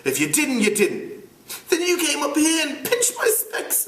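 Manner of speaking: put-on high, fussy boy's voice; Piggy's voice. Piggy scolding the older boys in a high voice. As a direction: pitch up into an indignant schoolboy.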